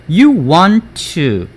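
"you want to" with "want to" joined on one t. Shown as a demonstration. Teacher recalls the linking rule, "want to" said as one. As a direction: run "want to" together slowly. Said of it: "한꺼번에 같이 발음한다는 거".

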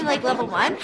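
ordinary delivery

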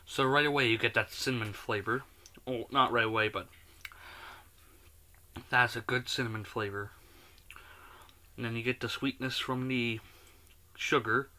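eating a cookie; chewing while talking. Real, not acted. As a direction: mouth partly full. Seen 2: holds cookie, bites near 3.2s, lips move as if chewing.

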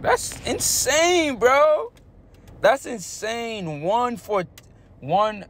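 high, slow amazed exclaim; squeaky slow awe. Real, not acted. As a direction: stretch it out in disbelief. Amazed at betting record.

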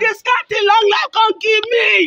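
slow, crying shout; wailing lament. Acted. Mother bemoaning what long life gave her, crying wail. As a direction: cry it out slowly, building toward a scream.

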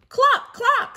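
very high, loud hen cluck; clucking cow. Acted. Teacher voicing Cow for her class, high loud clucking. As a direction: cluck like a chicken. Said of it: "said Cow".

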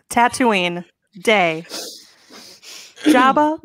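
normal talking voice

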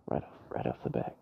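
unvoiced whisper, fast and muttered; whispering to self. Real, not acted. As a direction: whisper it under your breath. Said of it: "Is that English?".

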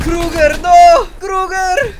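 high loud cry for a friend; anguished shout. Acted. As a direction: cry out in dismay. Teammate dies.

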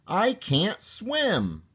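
high, slow little-frog protest; bubbling protest. A narrator's reading. Froggy clinging to his mother in the water, high slow protest. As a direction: stretch it out in a high kid voice. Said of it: "bubbled Froggy".

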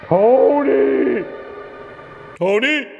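high, loud, drawn-out call from the dark; the darkness calling out to the boy; eerie night calling. Acted. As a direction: call his name like the night itself. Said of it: "as if the night itself was calling out to me".

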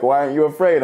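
loud, high voiced dad demanding an answer; challenging father. The father dangling his son off the balcony, a loud challenge. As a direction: play the dad daring him.

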